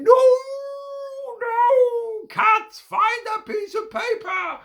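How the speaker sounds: very high, loud squeaky yelps; panicked dogs. Acted. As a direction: dogs crying out in alarm. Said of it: "the dogs would run around going".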